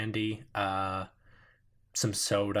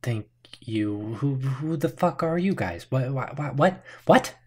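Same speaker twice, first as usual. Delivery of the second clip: higher, louder, flustered questions; rattled woman; 4 semitones higher, 7 dB louder than usual. Acted. Armed woman grills the newcomers, rattled and rising. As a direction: sputter in disbelief. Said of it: "the woman says".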